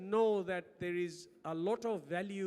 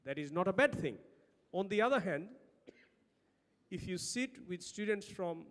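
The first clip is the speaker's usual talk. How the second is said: ordinary talk, nothing marked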